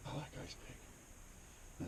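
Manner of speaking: soft, slow whispered quote; quiet slow imitation. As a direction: hush it out slowly. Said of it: "And he goes".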